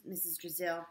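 ordinary delivery

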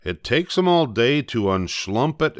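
usual speaking voice

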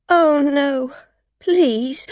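high, loud desperate plea; pleading. A narrator's reading. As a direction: beg her not to call. Driver begging not to call police.

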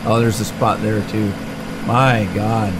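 ordinary delivery

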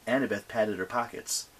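ordinary delivery

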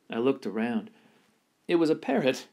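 ordinary delivery, nothing marked

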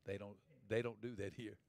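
very low, soft disapproving quote; hushed objection. Retold or imitated. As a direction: imitate a hushed reprimand. Retold hushed objection to clapping.

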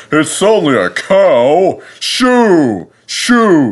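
high, drawn-out scornful shooing; hissed dismissal. A narrator's reading. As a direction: shoo it off like a pompous engine. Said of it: "he hissed".

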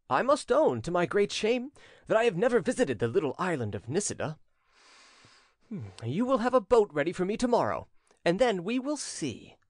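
high-pitched, mock-regretful prince; ironical. A narrator's reading. Prince confessing he never visited the island, ironical. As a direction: say it with an ironic smile. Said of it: "replied the prince with an ironical smile".

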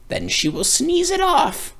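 high, crackly witch; high sly witch voice. Acted. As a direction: cackle out advice like a witch. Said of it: "the witch said".

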